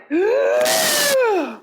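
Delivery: sharp high gasp of shock; horrified gasp. Retold or imitated. Seeing her red face in the mirror, high shocked gasp. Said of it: "I went".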